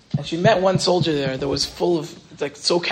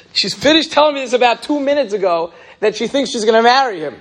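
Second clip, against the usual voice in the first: high, louder retelling of an excited student; excited news; 7 semitones higher, 5 dB louder than usual. Retold or imitated. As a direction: rush the good news out.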